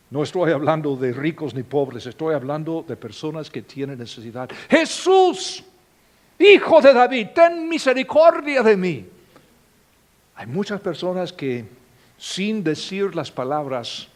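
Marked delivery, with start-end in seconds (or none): 4.7-9.0 a blind beggar's high, loud plea for mercy; desperate cry. Acted. Blind beggar crying out to Jesus, high and loud. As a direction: cry out over the crowd, begging.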